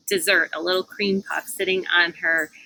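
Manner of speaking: ordinary delivery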